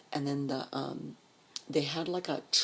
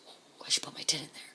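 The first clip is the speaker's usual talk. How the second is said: whispered thought; 3 dB quieter than usual. Retold or imitated. Narrator eyeing the tents, whispering a private plan.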